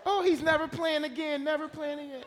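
loud, high-pitched mock outburst; hammed-up motherly hysterics; imitating distraught mother. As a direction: shout it as the overprotective, crying mom. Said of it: "she was crying, and she grabbed me".

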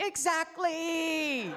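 high, loud, drawn-out mock shout; comically stretched high yell; God yelling back. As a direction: holler it slowly as a comic God. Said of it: "God said to me".

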